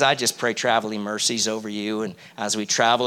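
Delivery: lightly put-on Southern accent. Preacher mocking a prayer partner in a low Southern accent. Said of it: "I'm trying to do a bit of an accent".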